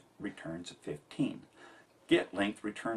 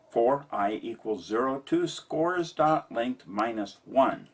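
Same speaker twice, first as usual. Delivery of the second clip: dictating; 3 semitones higher, 6 dB louder, slower than usual. Shown as a demonstration. Reading code line aloud.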